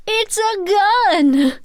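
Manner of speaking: loud, high, drawn-out cry; hammed-up shriek of alarm; dramatic alarm. Narrator acts out spotting a gun in a bush, dramatic alarm.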